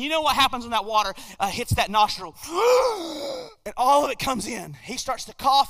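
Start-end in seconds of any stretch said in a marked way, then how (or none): 2.4-3.6 high, sudden gasp for air; sharp gasp. Retold or imitated. Drowning young man comes up from water, sharp gasp. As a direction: gasp like water just hit your nose. Water hits his nostril.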